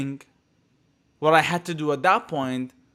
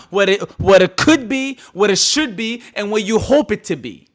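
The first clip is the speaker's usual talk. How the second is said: louder, rising lines recited like a poem; loud sing-song poet's cadence; poetic recital; 4 semitones higher, 7 dB louder than usual. Shown as a demonstration. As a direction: declaim it like a poet on stage. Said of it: "I'm a poet right now".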